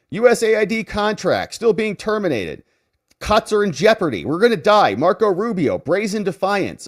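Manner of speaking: high, hammed-up panicked headline read; a touch of mock hysteria; mock alarm. Mocking the group's alarmist headlines.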